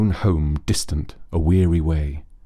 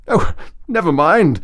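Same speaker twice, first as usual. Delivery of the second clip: higher, louder voice for Rat; 9 semitones higher, 5 dB louder than usual. A narrator's reading. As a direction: voice the Rat, bright and brisk. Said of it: "said the Rat".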